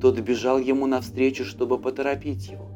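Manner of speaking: ordinary delivery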